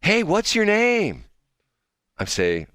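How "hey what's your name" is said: high, slow, over-friendly question; lady impression. Host voicing the lady who followed him to his table, high imitation. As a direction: mimic a forward, smiley stranger. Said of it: "she says to me".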